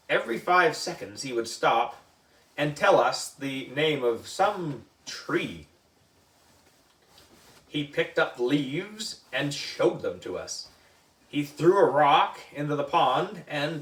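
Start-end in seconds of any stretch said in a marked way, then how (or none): none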